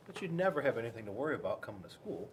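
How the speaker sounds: ordinary delivery, nothing marked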